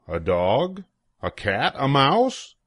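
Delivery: ordinary delivery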